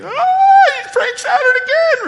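high, loud, slow panicked wail; hammed-up scared cry; mock panic. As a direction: play a churchgoer freaking out. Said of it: "You're like".

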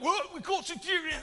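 a hint of a high, loud British old man; mock British accent. Lightly voiced British lord pounding the table. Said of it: "I can't do a very good British accent".

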